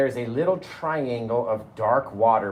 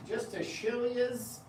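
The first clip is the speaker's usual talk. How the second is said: silly voice; 11 semitones higher, 6 dB quieter, slower than usual. Pretend. Sudden put-on voice.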